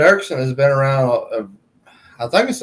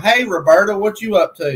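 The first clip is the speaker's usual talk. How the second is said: higher-pitched teasing greeting; teasing call; 7 semitones higher than usual. Retold or imitated. Ribbing the boss by a nickname, higher teasing call. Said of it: "And I'll call him. I'm like".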